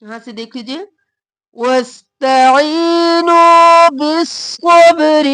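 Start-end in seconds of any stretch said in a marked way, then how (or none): 1.6-5.3 slow tajweed chant with lengthened vowels; Quranic recitation. Shown as a demonstration. Teacher reciting the verse to students, slow chant. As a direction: stretch each word as a qari would. Full verse read as model.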